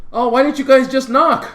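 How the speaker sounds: high, loud mock complaint; whining protest. Drunk friend to the guys walking in, whining protest. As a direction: imitate a woken friend's indignant protest.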